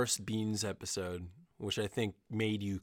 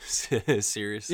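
slow drawn-out seriously; 5 dB louder, much slower than usual. Acted.

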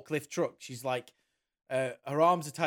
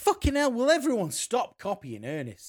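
loud high exasperated shout; exasperated outburst; 9 semitones higher, 5 dB louder than usual. Real, not acted. Venting to friends about Ernest copycats, loud exasperated shout. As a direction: throw up your hands and yell it.

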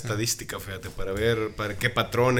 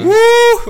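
loud, very high "uuuh"; long demonstrated high whoop; nonverbal hoot; 14 dB louder than usual. Shown as a demonstration. Showing a wordless local expression, high hoot. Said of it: "comunicación no verbal que tenemos".

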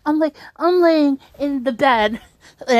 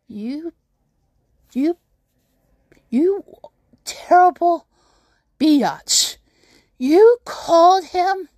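seething accusation; 4 semitones higher, slower than usual. Retold or imitated. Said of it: "and I'm like".

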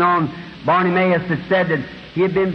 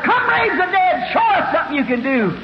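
high, taunting crowd shout; hollering; 7 semitones higher than usual. Acted. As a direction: heckler hollering a challenge. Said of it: "The other hollered".

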